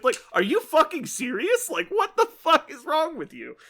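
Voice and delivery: high-pitched, exasperated disbelief; incredulous rant. Real, not acted. Gamer ranting at absurd sportsmanship scoring, incredulous. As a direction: let the voice leap up in outrage.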